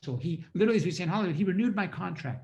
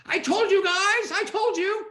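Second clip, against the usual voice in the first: much higher, louder, slow i-told-you-so; hammed-up exasperated blame; mock whiny outburst, drawn out; old told-you-so; 8 dB louder, slower than usual. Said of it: "instead of what I used to say".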